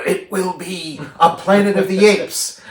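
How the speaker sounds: imitated ape leader's declaration, drawn out; Caesar's proclamation. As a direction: declare it slowly and high, like a rebel leader. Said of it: "And then he's like".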